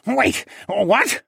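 high, loud startled yelp; startled squawk. A narrator's reading. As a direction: yelp in alarm. Penguin panics.

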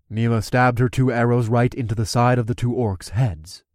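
usual speaking voice

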